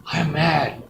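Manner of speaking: ordinary delivery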